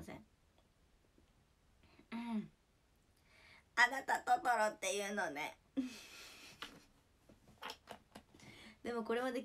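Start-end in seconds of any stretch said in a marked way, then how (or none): 3.8-5.6 loud, put-on character voice; film line impression. Streamer mimicking a Totoro line for viewers, loud character impression. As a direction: belt it out in a put-on cartoon-film voice.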